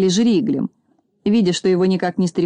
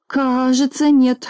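stammering mumble; 5 semitones higher, slower than usual. A narrator's reading. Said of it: "заикаясь от испуга, пробормотал Колосок".

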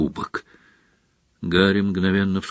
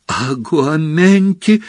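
slow, rising, higher-pitched call; exclaimed spell; 5 semitones higher, slower than usual. A narrator's reading. Harry casting the spell once more, exclaimed spell. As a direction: call out the spell, stretched and urgent. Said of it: "ещё раз воскликнул Гарри".

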